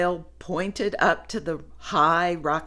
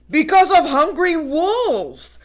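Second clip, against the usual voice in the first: high, loud, alarmed; fearful warning; ominous warning; 10 semitones higher, 8 dB louder than usual. Acted.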